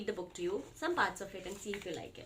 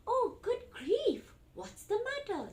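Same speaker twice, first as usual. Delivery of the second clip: high, slow alarmed exclaim; surprised storybook parent; startled parent; 12 semitones higher, slower than usual. Acted. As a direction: gasp it out as the worried parent. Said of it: "But the parent says".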